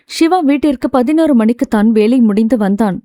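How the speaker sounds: usual speaking voice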